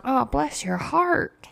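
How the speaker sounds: slow, soft "Oh, bless your heart"; slow sugary mock sympathy. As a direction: fake pity, drawn out. Sympathetic response to a story.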